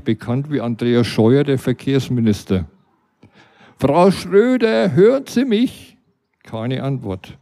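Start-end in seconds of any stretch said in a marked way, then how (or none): 3.8-6.0 loud, high call through a door. Retold or imitated. Narrator calls through the door to the neighbour, raised voice.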